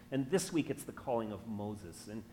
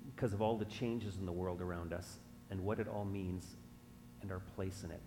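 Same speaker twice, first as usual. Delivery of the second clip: soft, lower, measured prayer; 4 semitones lower, 10 dB quieter than usual. Real, not acted. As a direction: pray quietly, with pauses between phrases.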